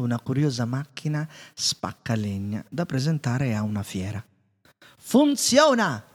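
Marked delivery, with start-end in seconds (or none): 5.0-6.0 high, stretched-out shout of joy; triumphant cry. A narrator's reading. Inventor Maurice shouting as his machine starts, high and drawn out. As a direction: inventor crowing over his working machine. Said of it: "gridò".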